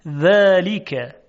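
ذلک very slowly, with a thin dental dhal and long first vowel; delicate dhal. Shown as a demonstration. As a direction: draw out ذلک, keeping the dhal light. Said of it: "صدای ذال صدای ظریفی هست". (ذلک خیلی کشیده با ذال ظریف)

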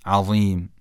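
"عظیم" with a heavy, thick ظ, tongue tip out; Za pronunciation. Shown as a demonstration. Teacher introducing the letter Za, "عظیم" with heavy ظ. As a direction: say "عظیم" with a full, emphatic Za. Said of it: "تلفظ ضاء مثل تلفظ ذال هست".

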